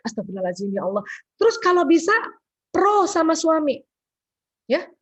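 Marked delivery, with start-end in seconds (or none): 0.1-1.0 low, soft murmured prayer; whisper-quiet plea for patience; quiet istighfar. Shown as a demonstration. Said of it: "setelah tahu". (istighfar lirih)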